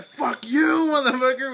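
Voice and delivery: high, loud mock yell; retold angry cussing. Acting out two friends cussing at a stranger at once, high angry yell. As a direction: re-enact the outburst, pitch leaping up. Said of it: "me and Edgar at the same time".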